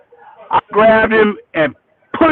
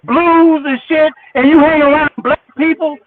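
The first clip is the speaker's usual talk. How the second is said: nail him with it, rising heat; heated call-out; 9 semitones higher than usual. Real, not acted. Calling Greg out.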